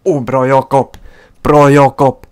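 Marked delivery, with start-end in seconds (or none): 1.4-2.3 louder shouted cheer; loud praise. Real, not acted. Praising the keeper twice.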